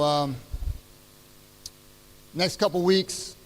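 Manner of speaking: normal talking voice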